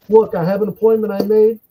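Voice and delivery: ordinary talk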